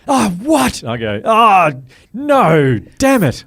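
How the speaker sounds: high, loud mock outrage noises; fake fan groans. Shown as a demonstration. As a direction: ham up the crowd reaction. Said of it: "I'm just making up noises here".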